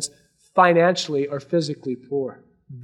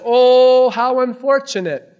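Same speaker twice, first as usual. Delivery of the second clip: pitying cry; 7 semitones higher, 6 dB louder, slower than usual. Acted. The world pities the poor.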